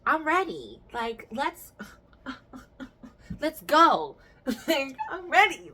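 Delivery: high, loud eager laugh; laughing eagerly. Retold or imitated. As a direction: laugh through being ready. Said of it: "I was like".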